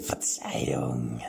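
slow, whispered apology; apologetic whisper. Acted. King apologizes to the frightened knight in a whisper. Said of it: "sagte er entschuldigend".

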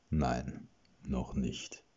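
low, grim answer; dark reply. Acted. Najam grimly answers the panicked witch, dark and low. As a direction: answer darkly, with quiet menace. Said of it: "gab Najam düster zurück".

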